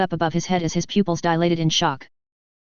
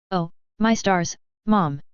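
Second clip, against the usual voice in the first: ordinary delivery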